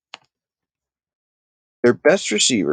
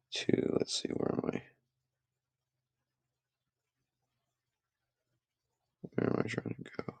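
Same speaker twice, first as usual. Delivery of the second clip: under-the-breath whisper to himself; hushed aside to himself; whispered muttering; 17 dB quieter than usual. Real, not acted. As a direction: mutter it in a whisper while searching the screen. Muttering to himself while searching.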